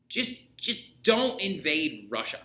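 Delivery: "don't" slow and pressed, a serious warning; stress on don't. Real, not acted. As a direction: drag out "don't invade Russia" like a stern piece of advice. Teacher warns future dictators.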